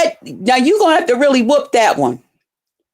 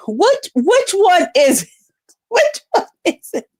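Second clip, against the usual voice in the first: mock bafflement; 7 semitones higher than usual. Real, not acted. Mocking the rival's mismatched bed, high and baffled. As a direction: ham up the confusion.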